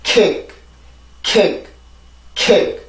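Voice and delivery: "kick" with a gently closed, barely released final k; soft final k. Shown as a demonstration. Teacher repeating the word for practice, soft final k. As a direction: say "kick" and stop the last k without a puff. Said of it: "다시 한번 발음을 해볼까요".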